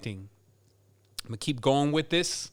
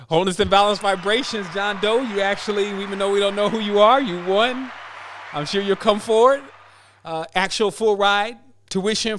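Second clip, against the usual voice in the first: higher and louder, bright and quick in the middle; excited congratulations; 4 semitones higher, 4 dB louder than usual. Real, not acted. Host congratulates the anonymous winner John Doe, excited and louder. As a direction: cheer the winner on with lifted energy.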